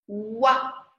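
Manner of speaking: usual speaking voice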